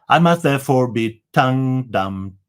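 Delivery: normal talking voice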